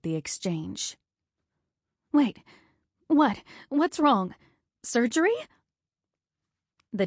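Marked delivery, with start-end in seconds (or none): 2.1-5.3 sharp, much higher rising alarm; the question jumps up in panic. Acted. Wife catching the nurses' look, alarmed and higher.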